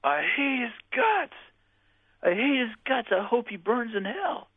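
very high, drawn-out retold yell; mock yelling. Tormented woman asked about her father, high mock yell. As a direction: imitate her outburst high and slow, without real volume. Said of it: "She yells".

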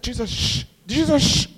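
mock-pleading with him to tone it down; lightly voiced nervous crowd shushing; hushing people. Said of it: "Sometimes people are like".

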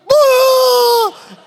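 high startled scream; son's scream. Retold or imitated. Son kicked in the mouth by a goat, sudden high scream. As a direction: yelp like a kicked kid. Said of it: "And he's like".

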